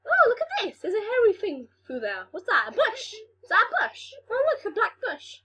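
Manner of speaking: high, squeaky-pitched character voice; high-pitched mock wonder; voice of the thing. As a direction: play a tiny creature marvelling, high. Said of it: "the thing goes through like".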